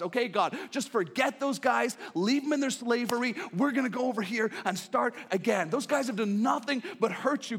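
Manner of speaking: high, loud, urgent angel voices pleading. Acted. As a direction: beg God to give up on humanity. Angels urging God.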